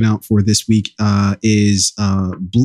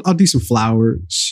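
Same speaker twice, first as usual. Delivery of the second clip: ordinary talk, nothing marked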